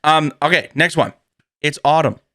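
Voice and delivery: ordinary talk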